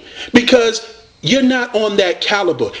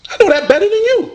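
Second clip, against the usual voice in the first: fast, high, cocky brag; high quick taunt thrown at the rival; taunting boast; 14 semitones higher, faster than usual. Acted. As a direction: fire it off like a gloating champ.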